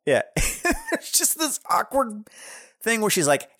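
ordinary talk, nothing marked

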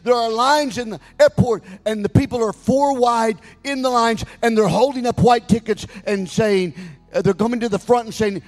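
high, shouted preacher's crescendo; full-force preaching. Retold or imitated. Pastor retelling the airport part of the dream, preached at a shout. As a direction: cry out the vision to the whole congregation.

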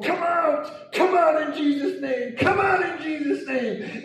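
high, drawn-out exorcism yell; commanding shout. Retold or imitated. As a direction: cast the demon out at a shout. Retelling exorcism, man held by neck.

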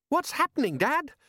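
very high-pitched frightened question; boy's voice rising in panic; alarmed son. Acted. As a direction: squeak it out to Dad. Son alarmed during escape.

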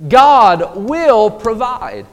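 each word laid down slowly; "God will provide" drawn out and solemn; slow, weighty proclamation. Shown as a demonstration. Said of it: "That is what Jehovah Jireh means".

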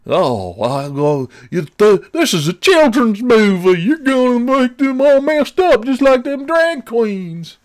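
high, slow, mocking pearl-clutcher whine; mock bigot voice. Mocking offended viewers, high, slow sneering whine. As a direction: lampoon the outraged parent, pitch way up.

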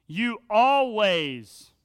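loud, drawn-out mock accusation; exaggerated accusation. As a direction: stretch the words out loudly, overdoing the blame. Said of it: "use exaggerated language like that".

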